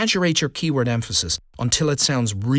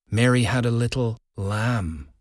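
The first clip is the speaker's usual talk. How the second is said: emphasize the last word, "lamb"; stress on lamb; 2 semitones lower than usual. Shown as a demonstration. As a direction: save the weight for "lamb", sounding it out. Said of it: "emphasizing different words".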